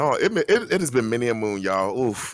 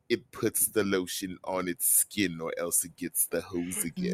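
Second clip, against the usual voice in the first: creepy captor impression; 4 semitones lower, 9 dB quieter than usual. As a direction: imitate a creepy captor giving orders. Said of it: "this voice gives the energy of".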